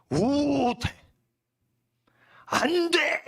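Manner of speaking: high, drawn-out proclamation; emphatic prohibition. Acted. Preacher acting out the original text's list of bans, emphatic prohibition. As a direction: intone each ban high and forcefully.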